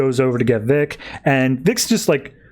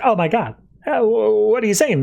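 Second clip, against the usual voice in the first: higher, lightly hammed-up gasp of shock; a touch of startled secretary; mock shocked; 9 semitones higher than usual. Bonnie overhears Sage's betrayal, mildly shocked and higher.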